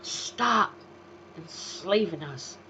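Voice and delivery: ordinary delivery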